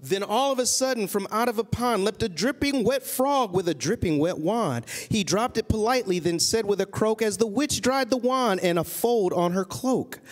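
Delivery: lilting rhyming read-aloud; story narration. A narrator's reading. Parent reading a witch story to a child, storybook narration. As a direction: read it to a child in steady verse. Reads a picture book aloud.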